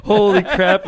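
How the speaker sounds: high, loud mock gasp; hammed-up shock. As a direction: react to the blowup. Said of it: "Zach and Lindsay are like".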